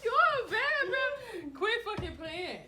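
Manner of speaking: high laughing protest. Real, not acted. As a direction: laugh through the words. Laughing at the fake fight.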